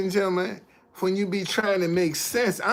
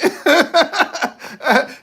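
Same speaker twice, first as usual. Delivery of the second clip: quick high burst of laughter; 9 semitones higher, 6 dB louder than usual. Real, not acted. Streamer to a missing viewer, short laugh.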